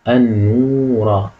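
النورة slow with a long held middle vowel; drawn-out model reading. Shown as a demonstration. Teacher presents the second word, slow drawn-out reading. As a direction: stretch out النورة for learners. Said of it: "الكلمة التانية".

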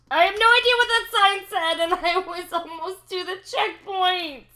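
fast, high, loud exasperated rant; racing, high-pitched complaint with a laugh; frantic rant. Real, not acted. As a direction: rattle it off in disbelief. Frustrated after falling.

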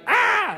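high mock baby wail; short imitated infant cry. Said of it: "he was crying".

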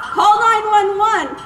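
loud call for help; shouting. Shown as a demonstration. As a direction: shout it out firmly. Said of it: "using our voice".

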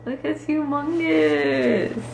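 high, drawn-out marvelling; real amazement. Real, not acted. Woman marvels at how big it is, amazed. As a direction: stretch it out slowly in amazement.

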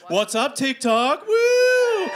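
high, drawn-out crowd hype; hyped-up greeting. Real, not acted. Host greeting the TikTok stream, high excited whoop. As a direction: whoop it up, climbing into a squeal.

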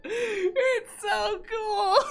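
high, slow, tearful laugh; laughing to tears. Real, not acted. Streamer in tears over the ducks. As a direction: squeeze the words out through crying laughter.

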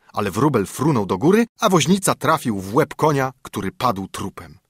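plain reading voice

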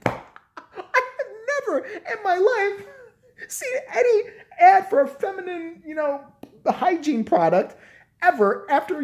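high laughing squeal; squealing laughter; laughing disbelief. Real, not acted. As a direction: laugh through the words, high up.

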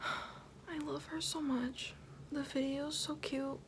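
slow, soft, dreamy sigh of love; soft swoon. Real, not acted. Fan sighing softly about her idol. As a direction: breathe it out, starstruck.

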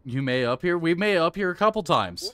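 loud and high, thrilled; excited shout. Real, not acted. Gamer surprised he made it up, cheering loudly. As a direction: cheer the unexpected progress.

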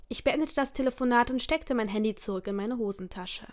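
usual speaking voice

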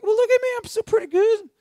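mock a smug churchgoer praising himself; smug self-talk. Preacher mocking a smug churchgoer, high put-on voice. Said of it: "We're like".

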